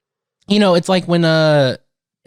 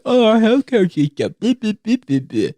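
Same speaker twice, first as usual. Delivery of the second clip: mocking voice; 5 semitones higher than usual. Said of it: "if you come at me with".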